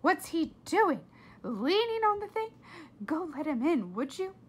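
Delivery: mother fed up with the ringing; irritated mother. A narrator's reading. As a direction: snap at the child to go. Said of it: "said her mother".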